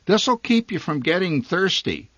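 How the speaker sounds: high, knowing mother's voice; sage motherly advice. A narrator's reading. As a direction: counsel like a wise mother. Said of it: "she counseled sagely".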